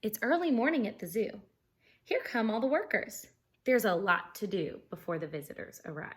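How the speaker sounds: bright, lively storybook reading; animated read-aloud for kids; reading aloud to children. Acted. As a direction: read it like story time for a class. Reading picture book to class.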